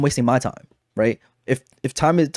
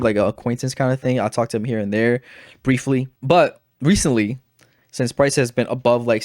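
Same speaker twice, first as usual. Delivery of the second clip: ordinary talk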